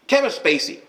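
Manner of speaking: high, loud burst of recognition; sudden recall. Real, not acted. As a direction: blurt the name out as it finally comes back. Forgotten name suddenly comes back.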